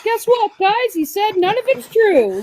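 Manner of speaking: high excited friend voice; imitating Blue. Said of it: "Blue's like".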